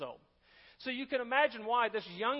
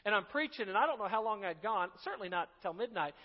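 usual speaking voice

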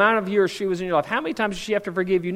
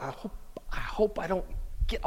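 whisper; 10 dB quieter than usual. Retold or imitated. Voicing people's secret inner hope.

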